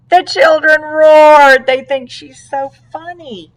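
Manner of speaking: slow, loud and high narration; excited. A narrator's reading. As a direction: boom out the uproar.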